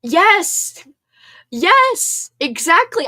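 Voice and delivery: loud, high, excited yeses; thrilled shouting agreement. Real, not acted. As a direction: cheer in agreement.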